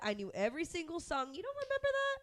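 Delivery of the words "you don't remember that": incredulous. Real, not acted. Incredulous question.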